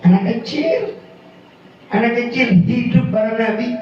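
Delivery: ordinary talk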